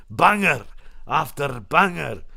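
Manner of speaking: slow Scottish take on a catchphrase; Scottish accent. Retold or imitated. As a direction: put on a Scottish accent, roll the R's and draw it out. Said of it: "I put the Scottish accent on".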